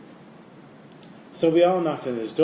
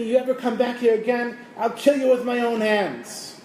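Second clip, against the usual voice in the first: retold scream; 10 semitones higher than usual. Beggar retelling Yosele's threat as he throws him out, screaming. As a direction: bellow the threat down the stairs. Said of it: "And he screamed at me".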